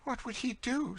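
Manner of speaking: very high, anxious question; hanging on the answer; breathless interest. A narrator's reading. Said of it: "looking with breathless interest".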